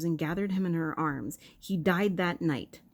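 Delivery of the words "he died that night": normal talking voice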